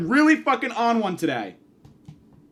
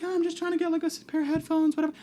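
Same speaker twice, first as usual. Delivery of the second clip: put-on high, soft girlish voice; lightly mocking high-pitched chatter; mimicked shopper girls; 7 semitones higher, 5 dB quieter, faster than usual. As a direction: imitate casual girls in a small, high voice. Mimics girls at the service desk.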